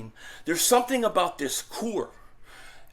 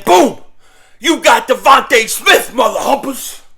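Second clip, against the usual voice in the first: loud, higher, fired-up yell; hyped shout; 5 semitones higher, 10 dB louder than usual. Real, not acted. Eagles fan celebrating Devontae Smith, hyped loud shout. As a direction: celebrate the big play out loud. Hyped about a big play.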